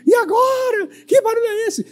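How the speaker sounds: very high, frantic woman's squeal; Cremilda. Acted. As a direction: wail it like a frightened cartoon wife. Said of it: "E aí, Cremilda".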